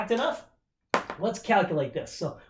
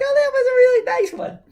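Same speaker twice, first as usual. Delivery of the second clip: very high, louder, sugary pretend praise; mock sweet voice; 7 dB louder than usual. Students surprised by an easy problem, high sugary coo. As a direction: coo it like the scary dog turned friendly. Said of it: "you're like".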